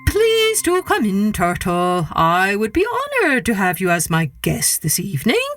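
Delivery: oozing false welcome, slowly; trickster tale voices. Acted. Anansi fake-polite greeting.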